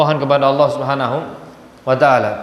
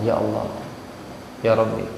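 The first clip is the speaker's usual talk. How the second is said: low, much softer, hushed plea; soft prayer; 6 semitones lower, 8 dB quieter than usual. Shown as a demonstration. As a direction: pray gently under your voice. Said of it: "dengan suara yang lirih". (doa lirih, jauh lebih pelan dan rendah)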